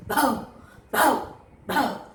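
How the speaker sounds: bow bow dog bark; playful dog bark demo. Shown as a demonstration. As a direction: bark each bow for the class. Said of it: "How do they bark?".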